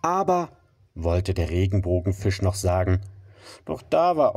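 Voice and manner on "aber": surprised protest. Acted. Fish starts to object.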